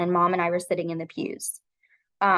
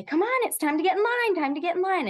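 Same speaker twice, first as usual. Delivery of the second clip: high sing-song call across the playground; calling out to a child; 10 semitones higher than usual. Retold or imitated. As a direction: call him in from recess, pitched well up. Teacher calling child to line.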